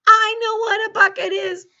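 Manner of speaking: loud, high-pitched protest; mock indignation. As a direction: exclaim it like a farmer defending his know-how. Farmer protests after misspelling bucket.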